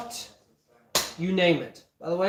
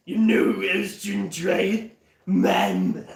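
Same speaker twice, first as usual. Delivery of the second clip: brash Muscle Man punchline; cartoon jock accent delivering the joke; Muscle Man catchphrase; slower than usual. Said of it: "if Muscle Man was in Star Wars".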